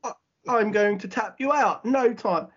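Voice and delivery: high-pitched mock English accent; awful English accent. Co-host imitates a wrestler's parody promo, awful English accent. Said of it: "it is an awful English accent".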